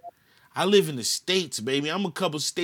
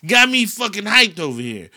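normal talking voice

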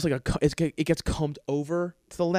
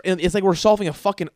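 ordinary talk

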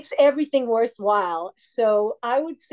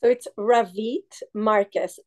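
ordinary talk, nothing marked

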